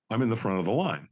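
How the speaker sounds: ordinary talk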